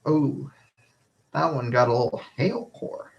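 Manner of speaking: usual speaking voice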